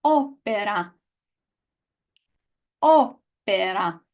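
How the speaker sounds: opera with a crisp unaspirated p, open vowels; Italian opera, slowly; Italian pronunciation. Shown as a demonstration. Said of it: "pronounce after me".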